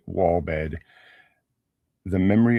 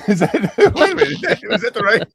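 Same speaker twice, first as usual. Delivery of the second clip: plain reading voice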